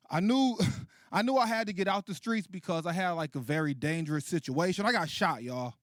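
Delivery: normal talking voice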